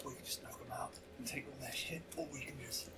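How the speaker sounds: breathy whisper with carrying volume; stage whisper. Real, not acted. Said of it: "Stage whispering".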